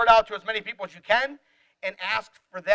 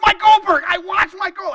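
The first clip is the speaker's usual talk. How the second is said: much higher, much louder fangirl squeal; imitating a thrilled student, high and loud; totally excited; 10 semitones higher, 10 dB louder than usual. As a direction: shriek the name like meeting your hero. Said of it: "Like, totally excited".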